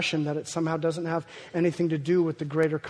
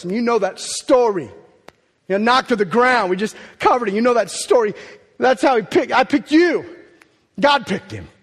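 forceful preaching; 4 semitones higher, 8 dB louder than usual. Acted. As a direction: proclaim it to the whole congregation. Reading scripture and preaching loudly.

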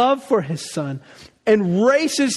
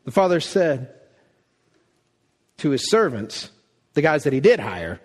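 ordinary talk, nothing marked

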